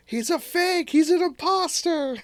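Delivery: put-on high squeaky kid voice; shrill-free but very high, outraged kid; mock kid yelling. Kid accusing fake Santa.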